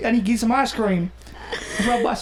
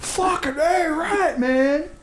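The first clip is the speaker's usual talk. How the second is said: much higher, pumped bro voice; hyped bro; 7 semitones higher, much slower than usual. Pretend. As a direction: ham up a fired-up dude.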